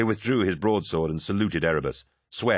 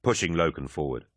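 ordinary delivery, nothing marked

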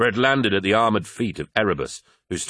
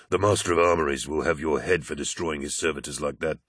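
lower, subdued Aximand-style dialogue; low-voiced character; 4 semitones lower than usual. A narrator's reading. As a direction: speak low, a restrained warning to a comrade. Dry warning in dialogue.

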